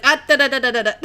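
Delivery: loud rapid string of syllables; loud mock scolding to halt someone; urgent stopping. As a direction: imitate a friend cutting someone off. Friend stopping speaker from chasing.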